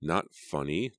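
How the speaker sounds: ordinary delivery, nothing marked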